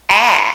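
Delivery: "a" sounded as a short front A, mouth wide; short a demo. Shown as a demonstration. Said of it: "a short A in the front of it".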